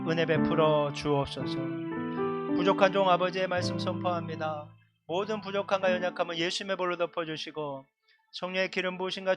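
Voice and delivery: earnest public prayer with sustained, even intonation; pastoral prayer. Real, not acted. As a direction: pray aloud for the people, phrase by phrase. Leads congregational prayer over music. (간절한 대표 기도)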